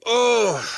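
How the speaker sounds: high cringing groan; loud groan. Shown as a demonstration. Man reliving an embarrassing moment, loud groan. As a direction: groan aloud in self-disgust. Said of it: "You still groan".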